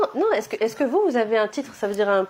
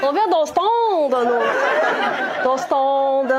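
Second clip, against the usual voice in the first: put-on loud, drawn-out Flemish accent; mock Flemish accent; 4 semitones higher, 6 dB louder, much slower than usual. Comedian mimicking audience member from Ostende, exaggerated Flemish accent. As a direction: parrot it slowly in a big Flemish accent. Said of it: "Ah, c'est l'accent flamand".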